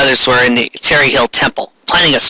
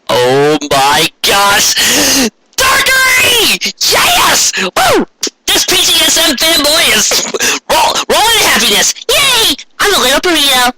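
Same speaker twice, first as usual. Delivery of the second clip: overjoyed fan squeal; 14 semitones higher than usual. Real, not acted. Narrator gushing about Dark Mercury, ecstatic high shouts.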